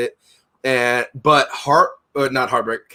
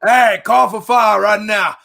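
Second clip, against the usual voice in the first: mock military shout; 6 semitones higher, 5 dB louder, slower than usual. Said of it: "Fire mission".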